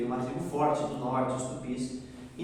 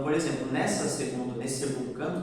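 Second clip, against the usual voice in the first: ordinary talk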